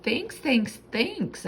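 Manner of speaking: high, quick grateful squeaks; squeaky thanks. A narrator's reading. Hamster thanking Missus Lawson, high squeaky voice. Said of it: "I squeaked".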